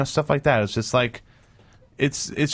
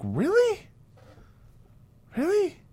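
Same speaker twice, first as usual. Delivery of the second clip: very high, softer disbelieving question; mock incredulity; 6 dB quieter, slower than usual. Reacting to shocked Facebook posts, incredulous. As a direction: squeak it upward in mock disbelief.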